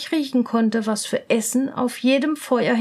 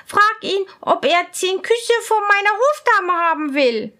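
much higher, louder impatient princess; 7 semitones higher, 4 dB louder than usual. Acted. Princess asks her lady, high and impatient. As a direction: demand the answer, high and curious. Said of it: "sagte die Prinzessin".

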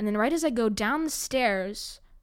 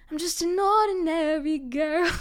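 higher, slowed, put-on plaintive voice; mock dramatic; 7 semitones higher, 4 dB louder than usual. To listeners, playing the innocent ordinary girl. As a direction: ham up the poor-me line. Mock self-pity after the story.

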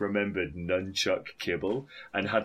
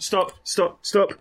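loud; 12 semitones higher, 8 dB louder, slower than usual. Real, not acted. Cuts off spelled username.

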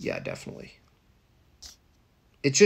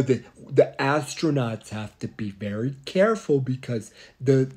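mock astronaut lecture. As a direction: drift through it like a spaced-out astronaut.

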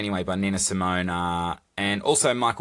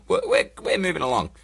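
usual speaking voice

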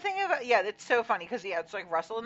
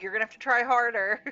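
louder teasing jab at a struggling player; mock taunt; 5 semitones higher, 9 dB louder than usual. Host taunting a struggling contestant, mock scolding. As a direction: needle her with a raised, mocking voice.

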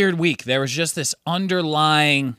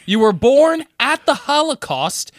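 retold incredulous protest; 12 semitones higher, 5 dB louder, slower than usual. Retold incredulous retort to patient.

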